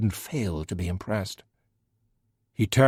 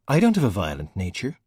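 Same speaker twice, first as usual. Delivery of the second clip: usual speaking voice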